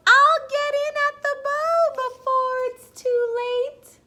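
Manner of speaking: high, loud donkey yell; high loud yell from the bow. Acted. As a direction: shout it as the donkey scrambling aboard. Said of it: "Who yelled".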